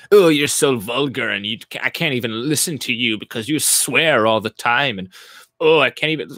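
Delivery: put-on voice of a scandalized viewer; mock offended complainer. Streamer mimicking viewers who object to swearing, mock offended.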